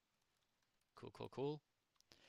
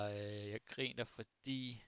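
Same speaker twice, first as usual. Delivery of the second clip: normal talking voice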